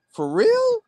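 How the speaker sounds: high squeaky "for real?"; amazed disbelief. Retold or imitated. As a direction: sound floored by the news. Retold disbelief, high far voice.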